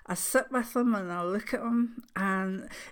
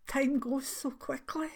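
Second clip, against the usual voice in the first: choked-up whisper, words barely voiced; hushed, fighting back tears; emotional whisper; 6 semitones higher than usual. Real, not acted. Grandmother moved by the new baby, whispering through tears. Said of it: "sorry, I'm getting emotional here".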